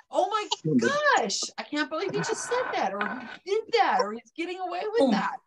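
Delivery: high, disbelieving exclamation; incredulous. Retold or imitated. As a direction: gasp it out in disbelief. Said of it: "you're really like".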